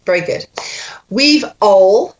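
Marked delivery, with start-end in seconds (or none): 1.1-2.2 slow Scottish model, two separate words; Scottish accent. Shown as a demonstration. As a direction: model Scottish vowels deliberately. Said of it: "I've got to go to Scotland".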